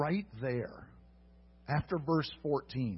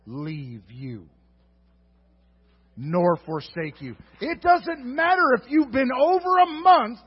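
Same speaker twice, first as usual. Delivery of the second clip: higher, loud, soaring proclamation; preaching at full force; 10 semitones higher, 8 dB louder than usual. Acted. Preacher climaxing to the congregation, loud and high. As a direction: drive it home with full fervour.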